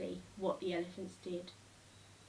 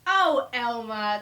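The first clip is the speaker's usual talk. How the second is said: louder, slow drawn-out gasp; 11 dB louder, slower than usual. A narrator's reading. Laughing elephants gasp at Elmer's joke. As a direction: let the name out on a gasp. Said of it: "they gasped".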